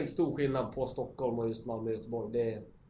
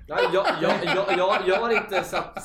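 laughing; 6 semitones higher, 9 dB louder than usual. Real, not acted. Caught out in the Göteborg argument.

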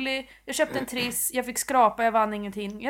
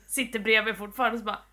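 usual speaking voice

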